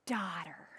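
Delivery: a tender whisper; soft whisper to the healed woman. Retold or imitated. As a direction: breathe the word to her. Jesus speaks to the healed woman.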